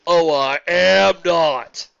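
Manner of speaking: slow loud offended retort; indignant umpire. A narrator's reading. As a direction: snap back offended.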